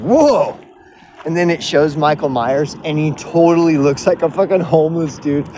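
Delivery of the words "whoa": startled whoa. Retold or imitated. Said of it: "And he's like".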